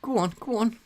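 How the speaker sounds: high, eager push; excited urging. Real, not acted. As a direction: cheer the run on. Urging a player.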